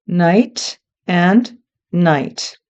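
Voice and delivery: slow, careful night and night; slow teacher demo of night and night. Shown as a demonstration. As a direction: stretch each word out for learners. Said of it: "they're spelled differently".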